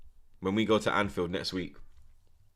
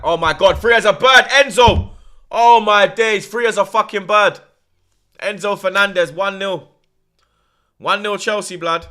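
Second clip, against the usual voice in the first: real, much higher, much louder disbelief; shocked yelling; 11 semitones higher, 14 dB louder than usual. Real, not acted. Fan shouts at the telly as Chelsea score, real shocked yelling. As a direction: yell it as the goal goes in. Reacting live to a Chelsea goal.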